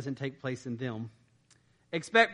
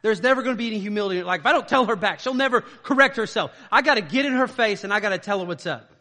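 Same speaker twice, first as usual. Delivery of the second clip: loud, high, fast hammed-up indignation; mock angry spouse; 6 semitones higher, 8 dB louder than usual. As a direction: play the fired-up spouse ranting. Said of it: "There's this idea that, like".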